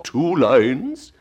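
crying out. A narrator's reading. Mock Turtle corrects the Gryphon about the lines, crying out. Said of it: "cried the Mock Turtle".

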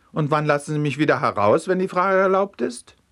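high, rising, slightly hurried query; protesting question. A narrator's reading. Vagabond anxiously questions the judge.

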